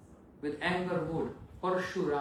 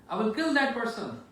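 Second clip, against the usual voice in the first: loud, higher, furious threat; an enraged sage vowing to kill; angry Parshuram; 5 semitones higher, 6 dB louder than usual. Acted. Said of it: "He was so angry".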